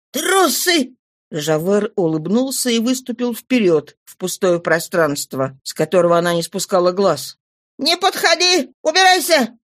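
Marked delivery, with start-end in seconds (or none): 0.1-0.9 high-pitched guttural contempt; hoarse, guttural mutter. A narrator's reading. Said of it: "пробормотала хриплым, гортанным голосом". 7.8-9.6 high-pitched threatening cry; shouting. A narrator's reading. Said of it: "крикнула она".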